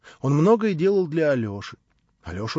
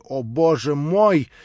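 slow, louder anguished exclamation; desperate cry; 3 semitones higher, 7 dB louder, slower than usual. A narrator's reading. Natasha crying out in despair at the insult. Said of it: "отчаянное восклицание Наташи".

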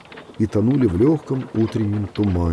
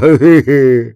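loud odd chuckle; a strange stuck chuckle; drunken chuckle; 8 dB louder than usual. A narrator's reading. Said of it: "произнёс он это".

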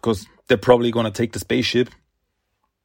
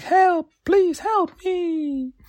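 much higher, slow, distant plea for help; call for help; much slower than usual. Retold or imitated. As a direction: wail it like someone trapped in the wreck. Voice calling from crashed ship.

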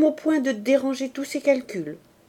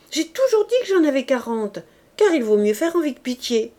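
heated tirade; 4 semitones higher, 5 dB louder than usual. Acted. Angry tirade about money.

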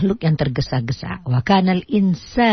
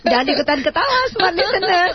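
higher, laughing through the words. Real, not acted. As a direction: scold playfully while cracking up. Menegur sambil tertawa. (menegur sambil tertawa)

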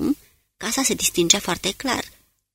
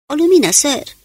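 higher, louder startled cry; alarmed exclamation; 8 semitones higher, 7 dB louder than usual. A narrator's reading. Kane spots a light at the house, alarmed. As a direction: blurt it out in alarm. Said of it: "exclamă alarmat Kane".

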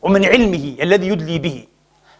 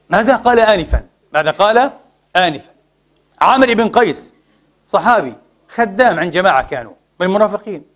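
ordinary talk

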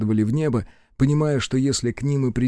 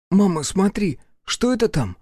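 put-on child voice; 8 semitones higher than usual. Child calls to mother.

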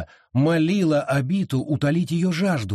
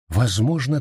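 ordinary delivery, nothing marked

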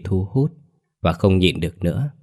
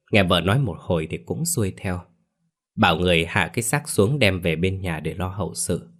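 usual speaking voice

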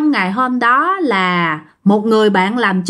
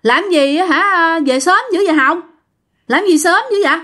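pitched up to carry to another room; raised voice; 6 semitones higher than usual. Acted. Woman calling to her friend outside the door, raised voice. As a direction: call out a puzzled question, mildly raised. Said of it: "nói lớn ra".

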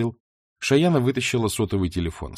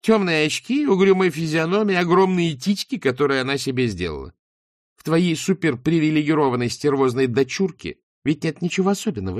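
gloating taunt; 6 semitones higher, 4 dB louder than usual. Acted. Shayana gloating to Beryl.

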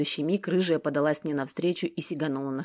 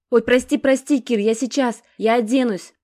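flustered apology; 7 semitones higher, 8 dB louder than usual. A narrator's reading. Sasha flustered over intercom.

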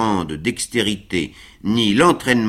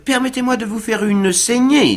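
eager doctor; 13 semitones higher, 4 dB louder than usual. A narrator's reading. Doctor offering bloodletting.

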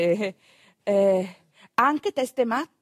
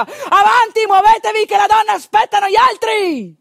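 much higher, much louder full-throated yell; shouting; 11 semitones higher, 9 dB louder than usual. Retold or imitated. Palmira yells at the drunk men outside the tavern, shouting. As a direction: bellow them out of the tavern. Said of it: "che gli gridava".

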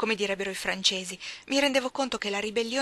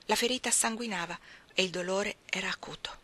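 ordinary delivery

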